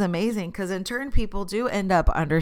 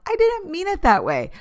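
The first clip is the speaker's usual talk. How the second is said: high-pitched flustered protest; squeaky apologetic backpedal; defensive protest; 13 semitones higher than usual. Retold or imitated. Caught off guard by conflict, high defensive protest. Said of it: "I'm like".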